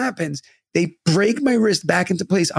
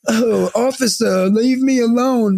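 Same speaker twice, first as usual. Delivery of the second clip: slow, high, drawn-out whine; high off face; 5 semitones higher, slower than usual. Retold or imitated. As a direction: imitate someone drugged, dragging each word. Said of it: "like, high off my face".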